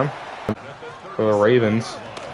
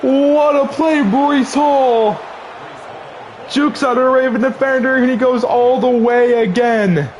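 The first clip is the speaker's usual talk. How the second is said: excited play-by-play; 11 semitones higher than usual. Real, not acted. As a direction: yell it like a live announcer. Big play in game commentary.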